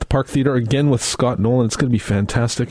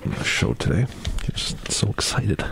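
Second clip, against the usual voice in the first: mock choked up; 6 dB quieter than usual. Radio host jokingly tears up about a July concert, in a hushed mock-choked whisper. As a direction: pretend to get choked up, breathy and hushed. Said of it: "I'm getting all choked up here".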